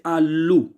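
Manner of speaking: आलू drawn out slowly, long ऊ at the end; example word. Shown as a demonstration. Teacher to students: slow example showing the oo vowel. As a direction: stretch आलू to show the oo sound. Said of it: "आ के बाद लू की आवाज़".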